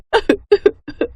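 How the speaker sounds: high, mocking cackle of a spiteful old woman; cruel cackle. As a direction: gloat over the beating with a laugh. Cruel grandmother laughs at beating.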